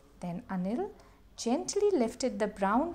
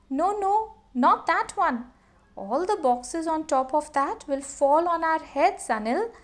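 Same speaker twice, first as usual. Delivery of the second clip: higher, louder warning cry; alarmed mother; 5 semitones higher, 6 dB louder than usual. Acted. Amma warning Anil off the stacked boxes, alarmed. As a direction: stop him before the boxes fall. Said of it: "said Amma".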